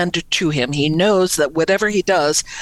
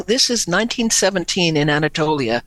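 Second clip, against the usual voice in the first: usual speaking voice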